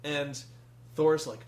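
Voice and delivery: usual speaking voice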